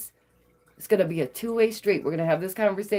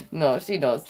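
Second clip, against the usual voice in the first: ordinary delivery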